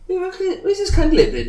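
high incredulous question; mock disbelief. Scoffing at the podcaster's claim, pitched high. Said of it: "it was like".

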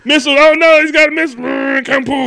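high, shouted action-scene alarm; mock panic. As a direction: yell a hammed-up warning amid mouth-made explosions. Acting out missile attack with noises.

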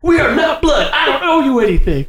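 hammed-up high dramatic renunciation; mock cold disowning. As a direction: declare it coldly in a silly high voice. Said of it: "Seth Rogen says".

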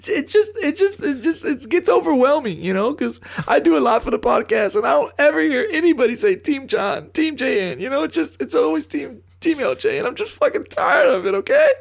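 high, wobbly mock sobbing; fake crying. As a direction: whine it like a hammed-up crybaby. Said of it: "It sounds like you're crying right now".

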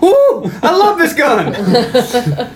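high, long-drawn gleeful cry; hammed-up action-movie glee; gleeful mock whoop. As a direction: whoop it high and stretch out every word. Acting out excited movie character's cry.

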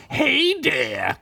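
high, squeaky mocking greeting; mocking Crinkles. A narrator's reading. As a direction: taunt him in a high little voice. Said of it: "Crinkle said mockingly".

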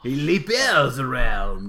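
slow, loud bad French accent; hammy French accent. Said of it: "my terrible French impression".

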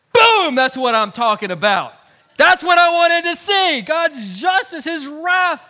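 gloating Jonah. Retold or imitated. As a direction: crow over the burning city. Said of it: "Jonah would be like".

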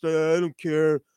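put-on sulky teen who won't do homework; pissed teenager. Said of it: "have that pissed".

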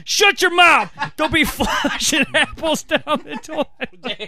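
high shouted scolding dissolving into laughter; mock furious grandpa. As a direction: bark it like a cranky old man, cracking up. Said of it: "You're like".

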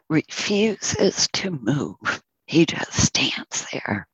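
normal talking voice

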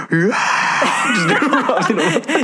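breathless giggly cheer; laughing gasp. Real, not acted. Imagined Super Bowl crowd reaction.

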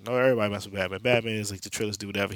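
normal talking voice

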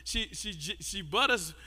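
soft, low, slow fond teasing; teasing tenderness. Real, not acted. Preacher fondly teasing his wife before the church. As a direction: tease her gently and lovingly.

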